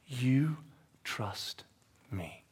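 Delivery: tender whispered question; softly ask, fading to breath; Jesus, whispering. Acted. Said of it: "And Jesus says".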